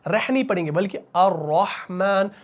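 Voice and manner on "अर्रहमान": अर्रहमान slow, र thick and full-mouthed; heavy ra. Shown as a demonstration. Teacher contrasting wrong and right, slow heavy ra. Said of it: "मुंह को आपने भारी करना है".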